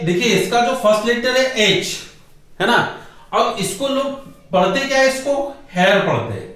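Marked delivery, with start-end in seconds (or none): 5.7-6.0 हेयर with a sounded h, hay-er; wrong pronunciation. Shown as a demonstration. Teacher quoting how people wrongly read it, with an h. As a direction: sound the h in हेयर as the common mistake. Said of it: "लेकिन ये हेयर नहीं है".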